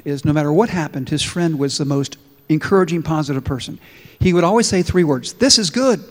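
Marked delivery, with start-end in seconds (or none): 5.3-6.0 cheerful catchphrase. Acted. Friend's catchphrase.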